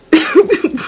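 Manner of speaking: high burst of laughter; big real laugh; loud laugh. Real, not acted. Laughs at own story.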